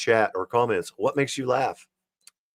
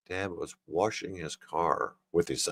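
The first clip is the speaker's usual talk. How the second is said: lower, softer, thinking aloud; reading to oneself; 4 semitones lower, 10 dB quieter than usual. Real, not acted. Rereads the joke to himself.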